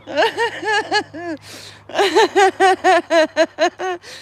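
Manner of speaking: snorting through the giggles; real snort-laughing. Real, not acted. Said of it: "I'm snorting".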